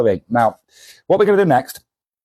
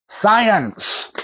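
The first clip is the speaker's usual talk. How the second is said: high drawn-out science, falling; mock grand announcement; 9 semitones higher than usual. Comic shop owner kicking off the experiment, hammed up. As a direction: sing out the word like a showman.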